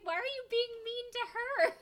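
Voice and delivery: mock-whine it like a hurt teen; whiny daughter. Daughter pleads, retold.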